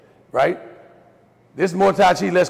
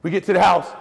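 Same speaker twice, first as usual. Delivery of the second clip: ordinary delivery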